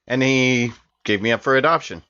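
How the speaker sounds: normal talking voice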